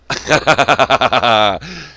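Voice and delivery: big loud cackle; loud laugh. Real, not acted. After joking about himself, a loud cackle. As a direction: laugh hard at your own joke. Laughs at his own joke.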